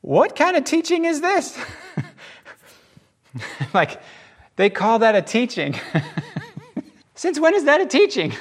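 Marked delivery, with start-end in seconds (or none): 0.0-1.6 high, astonished question; amazed crowd. A narrator's reading. The crowd asking each other, high and amazed. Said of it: "listen to their questions". 7.1-8.3 high, disbelieving question; as if it can't be real; incredulous. A narrator's reading. The crowd doubting the teaching, high and incredulous. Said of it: "listen to their questions".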